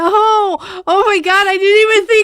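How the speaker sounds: loud, high, stretched-out shock; shocked exclamation. Real, not acted. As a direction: blurt it out in amazement. Sudden realization, shouting.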